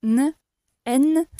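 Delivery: teacher's N; clear N demo. Shown as a demonstration. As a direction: model the N carefully. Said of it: "on dit N".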